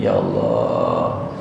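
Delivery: low, slow, dragged-out "Ya Allah"; mock groaning husband. Fed-up husband praying about his nagging wife, low and slow. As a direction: sigh it out like a worn-out husband.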